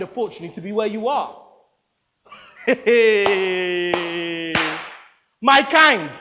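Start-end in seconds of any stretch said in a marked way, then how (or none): 2.6-5.0 a long mocking laugh; scornful laugh. Actor laughing scornfully at the producer's insult. 5.4-6.1 louder, higher bitter repeat; indignant echo. Acted. Actor flinging the producer's phrase back indignantly.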